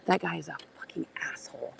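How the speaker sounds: hushed, unvoiced aside to the camera; whispered aside. Real, not acted. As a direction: whisper it so he can't hear. Whispering aside about rude man.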